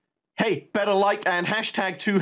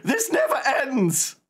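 laughing exasperation; slower than usual. Real, not acted. Exasperated at repeating cutscene.

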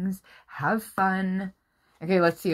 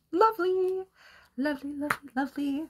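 high cooing; 9 semitones higher, 4 dB quieter, faster than usual. Real, not acted. Admiring card softly.

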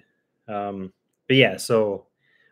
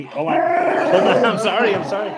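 higher hold on, I'm sorry; high apology to puppy; 8 semitones higher, 6 dB louder than usual. Real, not acted. Owner apologizing to her puppy in a higher voice. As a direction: soothe the puppy in a raised voice.

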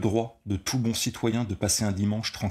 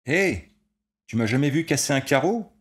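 loud, higher boastful yell; bragging shout; 8 semitones higher, 6 dB louder than usual. A narrator's reading. Frankie showing off to his mates, boastful shout. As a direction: shout it out to show off. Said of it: "cria Frankie".

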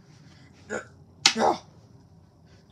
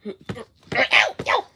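ordinary delivery

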